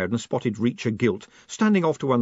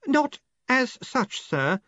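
high, slow, careful correction; well-honed patience; 14 semitones higher, slower than usual. A narrator's reading. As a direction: patiently correct your superior. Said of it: "with well-honed patience".

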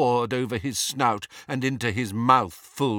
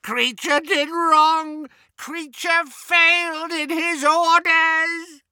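high, slow, strange little elf voice, self-punishing; tormented elf shrieking his failure; wailing house-elf; 6 dB louder, slower than usual. A narrator's reading. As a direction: cry it out like a shamed house-elf.

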